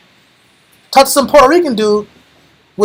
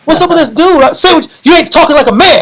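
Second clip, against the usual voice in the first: loud, higher, macho challenge in a put-on voice; tough camp brother; 6 semitones higher, 4 dB louder than usual. Camp brother mocking a young convert, loud and macho. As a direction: play the hairy loud-talking brother calling him out.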